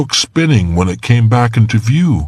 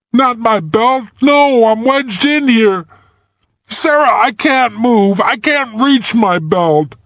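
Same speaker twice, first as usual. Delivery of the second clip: put-on high, strained young voice; helpless and stuck, pleading; boy wedged in ice, pleading up to Sarah; trapped boy. A narrator's reading.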